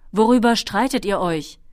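loud questioning call. A narrator's reading. Dragon asks his riders about their quarrel, calling out. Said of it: "rief der Drache".

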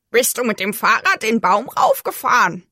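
put-on crow voice, astonished question; incredulous crow. A narrator's reading. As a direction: ask it as a disbelieving crow. (ungläubige Krähenstimme)

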